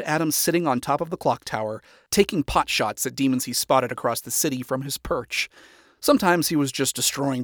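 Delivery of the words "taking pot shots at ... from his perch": usual speaking voice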